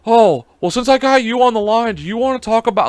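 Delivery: imitating a chirpy sales caller; pushy telemarketer. Scam caller pushing a sale on the host, mock salesman. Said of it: "I'm like".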